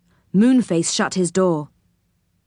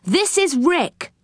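tag; 9 semitones higher, slower than usual. Acted. Joe introduces Rick in story.

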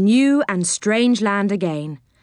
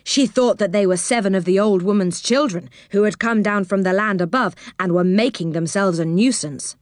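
ordinary delivery

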